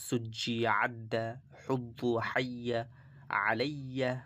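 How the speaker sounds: slow, drawn-out drill reading of Arabic syllables; slow recitation. Shown as a demonstration. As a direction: recite each syllable slowly for students. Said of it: "Arabic syllables read as drill".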